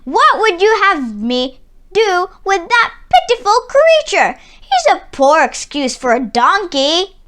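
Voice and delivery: loud, high, sneering tyrant voice; scornful tyrant. A narrator's reading. Tyrant scorns the humble donkey gift, loud and sneering. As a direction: spit out the contempt of a greedy ruler.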